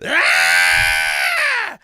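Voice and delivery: sharp gasping cry; startled shout. Retold or imitated. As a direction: yelp of shock. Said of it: "I went".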